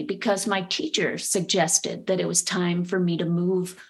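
ordinary talk, nothing marked

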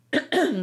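sharp cough to clear the throat. Real, not acted. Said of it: "sorry, I'm coughing a lot today".

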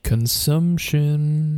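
very slow voiced spooky drawl; drawn out. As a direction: stretch every syllable out. Echoing the word again, voiced.

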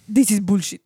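laughing, in a put-on Scottish accent; mock Scotch accent. Host's co-host mocks him in a Scottish accent. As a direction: growl it out like a Scotsman. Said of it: "In a Scotch accent".

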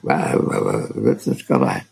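low mock grumble, dismissive; grumbling. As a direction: grumble it back like an annoyed surgeon. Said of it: "he grumbled something like".

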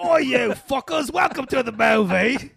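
loud, high, shouted mock Cockney; Cockney accent. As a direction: yell a rowdy greeting in broad fake Cockney. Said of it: "He's got a Cockney accent".

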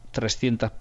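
ordinary talk

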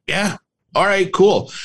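eager agreement. Retold or imitated. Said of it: "And, like".

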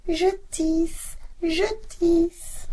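put-on very high, slow girlish voice; the cat squeaks like a young girl; cat faking the girl. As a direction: tomcat imitating a young girl's voice. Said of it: "répondit".

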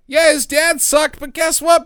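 usual speaking voice

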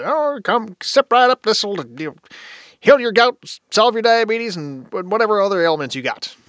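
high, loud carnival huckster pitch; snake oil salesman. Acted. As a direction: hawk it like a medicine show barker.

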